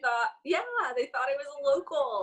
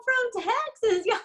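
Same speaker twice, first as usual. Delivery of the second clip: put-on Texas accent; 9 semitones higher, 3 dB louder than usual. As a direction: lay the Texas accent on thick. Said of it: "turned up the Texas accent".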